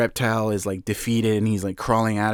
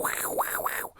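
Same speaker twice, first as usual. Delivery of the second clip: mock panicked gasps; 12 dB quieter than usual. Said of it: "Reptile's, like, freaking out".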